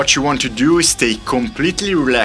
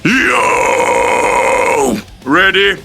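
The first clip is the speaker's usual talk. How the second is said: raspy screamed hype call; false chord scream; 5 semitones higher, 3 dB louder, much slower than usual. Shown as a demonstration. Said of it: "Here's what it sounds like".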